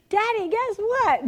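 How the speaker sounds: put-on high, slow child voice; excited little boy. Little boy telling daddy about the bird, imitated excitement. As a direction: imitate a child bursting with news.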